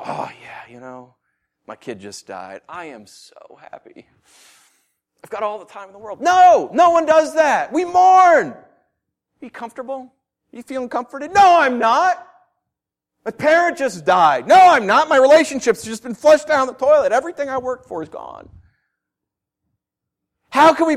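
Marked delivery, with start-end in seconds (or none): 0.0-6.2 quiet, half-whispered imitation of a grieving parent claiming happiness; mock bereaved parent. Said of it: "they're not gonna go". 6.2-8.5 loud and higher, firmly rejecting; emphatic shout. Real, not acted. Rebutting the absurd reply. 8.5-18.5 louder, higher protest of someone in loss; grieving person's outburst. Acted. Voicing mourner asked if happy.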